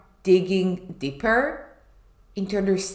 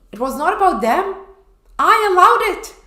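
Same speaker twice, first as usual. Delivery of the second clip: rising to a high, loud cry; building to an emphatic high exclamation; 11 semitones higher, 8 dB louder than usual. Real, not acted. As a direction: hit the word allowed hard. Emphatic realization.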